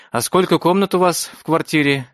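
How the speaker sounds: pry sweetly into his home; nosy matron voice. A narrator's reading. Matron probes nosily.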